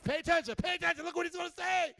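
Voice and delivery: loud, high, rushed urging; urgent heads-up. Acted. Excited follower tells his neighbor to watch Jesus, urgent shout.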